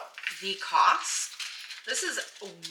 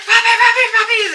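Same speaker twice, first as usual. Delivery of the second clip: high, loud squealing call; excited yell; 15 dB louder, faster than usual. Retold or imitated. Yelling to husband Paul about the puppies, loud high squeal. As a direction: shriek it across the yard. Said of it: "I yell at Paul".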